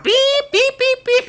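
piercingly high, loud beeping; imitated alarm. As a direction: mimic an electronic alarm at full blast.

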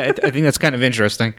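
ordinary talk, nothing marked

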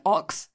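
ox said like aux in auxiliary cord; imitated AUX vowel. Friend mimicking co-host's ox, sounding like aux. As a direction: say "ox" with an American AH, like aux. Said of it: "you still say A U X".

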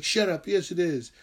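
mock snappy comeback, not shouted; lashing out. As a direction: imitate yourself arguing back. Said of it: "I would lash out at them".

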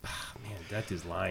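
a mock whispered scoff; sceptical whisper. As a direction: breathe it like a private doubt. Acted inner reaction to posts.

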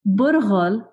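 Burgol said the Arabic way, rolled r, no English u; Arabic pronunciation. Shown as a demonstration. Reading her own pronunciation of Burgol to followers. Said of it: "knowing the word as burgol".

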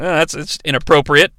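ordinary delivery

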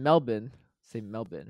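Melbourne as "Melbin"; Australian pronunciation. Shown as a demonstration. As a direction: end Melbourne in a short "bin". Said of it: "pretend it's like a bin in the end".

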